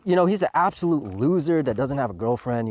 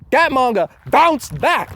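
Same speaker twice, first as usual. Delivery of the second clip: Gordon Ramsay; 9 semitones higher, 6 dB louder, slower than usual. Retold or imitated. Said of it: "like Gordon Ramsay says".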